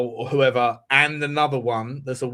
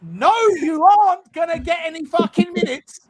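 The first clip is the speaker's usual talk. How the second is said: much higher, louder heated shout; 12 semitones higher, 4 dB louder than usual. Real, not acted. Heated football argument.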